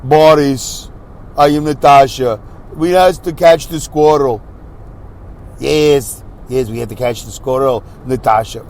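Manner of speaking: Americanized mock-Russian cartoon villain voice; Boris and Natasha accent. Podcaster imitating Rocky and Bullwinkle villains, hammy Russian accent. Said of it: "Boris and Natasha ... their accents were Americanized".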